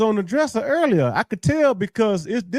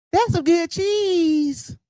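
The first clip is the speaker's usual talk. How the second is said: higher, slow, shouted parody; mock quote shout; 8 semitones higher, much slower than usual. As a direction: drag it out and shout it like a mocking chant. Mocking the clip line.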